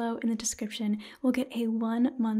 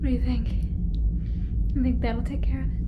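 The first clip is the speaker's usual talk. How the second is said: louder spoken roleplay, giving orders; sci-fi crew member; 6 dB louder than usual. Acted. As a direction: call out commands to the ship computer, louder. Sci-fi roleplay, hunting the alien.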